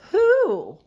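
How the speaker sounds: high, loud incredulous "who"; loud exclamation. Real, not acted. Scolding Tucker the spoiled dog, loud and high. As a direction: burst out in disbelief.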